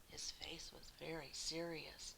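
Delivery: quiet serious narration; hushed. A narrator's reading. Narrator hushes as the worried postman arrives. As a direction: drop to a hush for the bad news.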